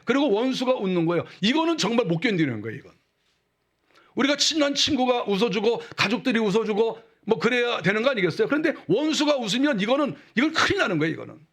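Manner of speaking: ordinary talk, nothing marked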